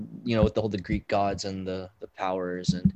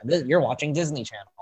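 Disney Channel ident; 4 dB louder than usual. Retold or imitated. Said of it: "I like Chris's in the other episode".

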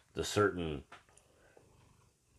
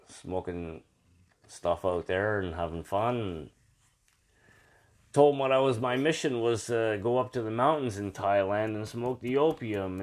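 usual speaking voice

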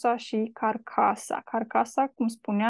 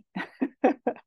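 laugh; 4 semitones higher than usual. Real, not acted. Laughs at her taci pun.

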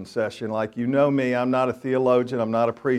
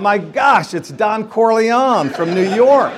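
shocked realization; 8 semitones higher, 7 dB louder, slower than usual. Retold or imitated. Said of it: "And I thought".

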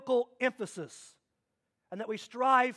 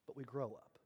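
much lower and softer, almost under the breath; soft punchline; 12 semitones lower, 18 dB quieter than usual. Real, not acted. Preacher lands his balance point, soft and low. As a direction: let the last line fall gently.

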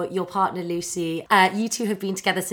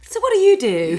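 mock celebrity small talk; 11 semitones higher, 7 dB louder than usual. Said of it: "someone could turn to us and be like".

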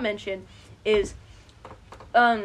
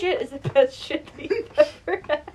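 laughing; 4 semitones higher, 3 dB louder than usual. Real, not acted. Laughing while insulting.

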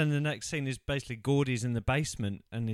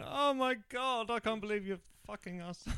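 hammed-up horrified mother, pitched way up; imitating appalled mum; 11 semitones higher than usual. Acted mum appalled coming downstairs.

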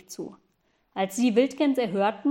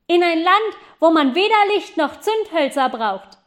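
high, loud answer; boy calling down to the girl, loud and high; calling back; 6 semitones higher, 7 dB louder than usual. Acted. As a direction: shout the answer down.

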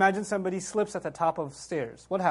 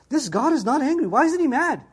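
puzzled reader; 6 semitones higher, 4 dB louder than usual. Retold or imitated. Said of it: "he's reading this and he's like".

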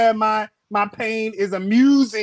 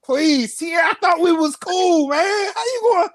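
mock hurt plea; 8 semitones higher, slower than usual. Mock betrayal pleading to friend.

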